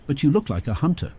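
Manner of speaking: curious. A narrator's reading. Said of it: "Curious, Olivia asked".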